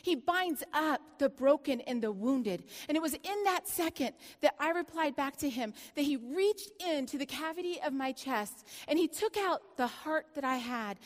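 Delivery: ordinary talk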